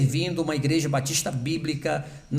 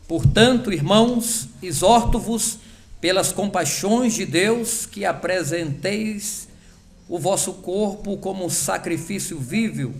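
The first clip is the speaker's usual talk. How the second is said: scripture reading; 3 semitones higher than usual. Real, not acted. Pastor reading Romans twelve to the congregation, solemn scripture reading. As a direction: read the verses slowly as holy words.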